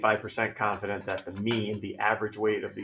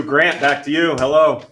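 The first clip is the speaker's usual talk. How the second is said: sarcastic hello; 3 semitones higher, 11 dB louder than usual. Real, not acted. Teacher calls the distracted student again.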